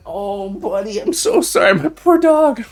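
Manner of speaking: tender pet talk. Real, not acted. Streamer consoling his dog during the thunder, high and tender. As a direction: coo over the frightened dog.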